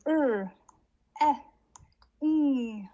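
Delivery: each sound held, high and loud; sounding out words. Shown as a demonstration. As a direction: sound out r, i, ng for children. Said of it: "so I would say".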